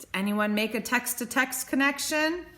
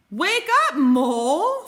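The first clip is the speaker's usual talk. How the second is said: loud, bright call; cheerful wake-up call; 4 semitones higher, 7 dB louder than usual. Acted. Bear waking up the sleeping mole, loud and bright. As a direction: call him awake cheerfully.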